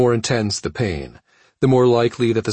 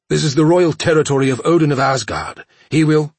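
choked, defiant outburst; choking; 8 semitones higher, 6 dB louder than usual. Acted. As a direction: splutter it out defiantly. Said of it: "he choked out".

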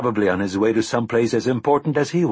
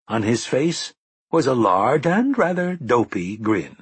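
usual speaking voice

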